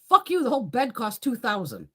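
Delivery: indignant retold shout; outraged yell. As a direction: blurt it out in outrage. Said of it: "I'm like".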